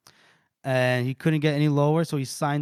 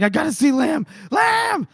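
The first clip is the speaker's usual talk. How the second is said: high-pitched desperate yell, acted out; mock screaming; 11 semitones higher, 3 dB louder than usual. Naked man calling for Lamb at the guards, mock screaming. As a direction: cry out the name, rising much higher. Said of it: "he's out here screaming for Lamb".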